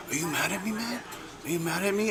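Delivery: high, soft needy asking; drunk guy's needy question. Retold or imitated. Drunk coworker asks the teller if he's mad, high and soft. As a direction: imitate a drunk pleading, a touch hurt. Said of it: "He goes".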